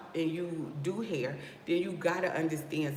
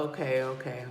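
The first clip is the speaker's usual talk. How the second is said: normal talking voice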